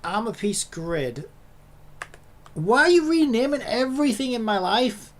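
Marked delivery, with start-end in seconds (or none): 2.5-5.1 higher, louder exasperated rant at the machine; emotional outburst. Real, not acted. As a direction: let the frustration spill out, loud and high. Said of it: "I'm getting very emotional".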